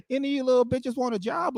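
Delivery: high, slick pimp offer; pimp dad voice. Retold or imitated. Pimp dad offering the eighth graders a job in pimp voice. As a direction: pitch it like a sleazy recruiter. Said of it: "Hey, baby".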